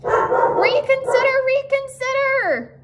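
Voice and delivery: very high, slow goose squawk; goose crying out. A narrator's reading. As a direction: plead in a drawn-out, high goose cry. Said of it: "cried the goose".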